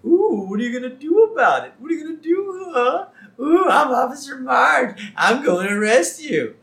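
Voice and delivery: high, loud taunting sing-song; mocking. Husband mocking his police wife, taunting. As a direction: jeer at the officer like a bratty child. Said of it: "And he keeps mocking her".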